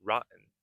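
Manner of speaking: ROTTEN as rot-n with a stopped T; swallowed T. Shown as a demonstration. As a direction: catch the T of ROTTEN in the throat. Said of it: "you can try saying".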